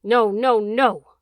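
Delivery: loud, drawn-out dog howl; loud, wavering howl; malevolent howl. A narrator's reading. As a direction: howl the refusal right over the plea. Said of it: "the dog howled".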